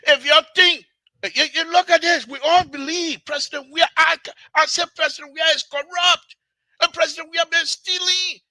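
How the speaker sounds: loud, high-pitched indignant rant; heated challenge. Real, not acted. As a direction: hammer the accusation out at a shout. Heated challenge to government supporters.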